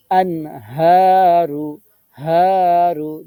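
আনহারু with a hard pushed হা and the vowel stretched one alif. Shown as a demonstration. Teacher demonstrates the pushed হা for students, slow and loud. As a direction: push the হা out, then hold the vowel. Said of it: "ধাক্কা দিয়ে এক আলিফ টানতে হবে".

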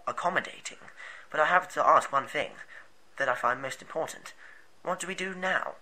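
soft, resigned character voice; quiet and weary, lightly voiced; second character voice.

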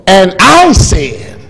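slow, drawn-out pulpit reading; preaching at full force. Acted. Preacher reading Acts to the church, drawn out and loud. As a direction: preach the scripture big and slow.

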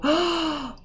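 sharp intake of wonder; amazed gasp. A narrator's reading. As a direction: gasp at a marvellous discovery. Amazement at the snow.

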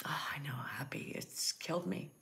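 very soft, near-whispered confiding; hushed, heartsick. Real, not acted. Host confiding in a viewer while holding back from crying, hushed. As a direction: say it under your breath, holding back tears.

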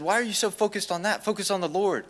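high-pitched, mock outburst; retold outburst. To classmates talking revival, a high retold outburst. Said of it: "I had an outburst in class".

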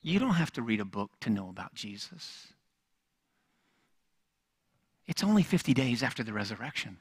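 preacher dropping to a soft, sincere hush. Acted. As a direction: draw them in quietly. Hushed intimate preaching.

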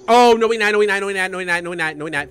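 panicked babble. Real, not acted. Player finding an enemy alive, panicked babble. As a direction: start high and loud, then let it fade as you repeat.

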